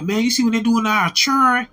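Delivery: high, loud imitation of a country man; country accent. Country man asking about our children, imitated country accent. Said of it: "And that's exactly how he said it".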